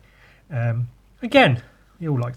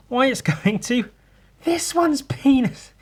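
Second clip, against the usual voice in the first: giggly reveal; 12 semitones higher than usual. Real, not acted. Comic reveal, laughing.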